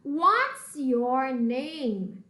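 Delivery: very slow "What's your name?"; slow model question. Shown as a demonstration. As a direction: spell it out slowly for learners. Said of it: "mas eu falo what". (bem devagar, pergunta modelo)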